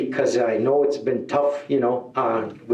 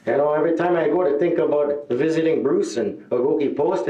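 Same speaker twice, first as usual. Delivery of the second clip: usual speaking voice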